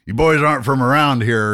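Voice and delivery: small-town barman sizing up strangers; mock threatening local; menacing bartender. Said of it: "he says".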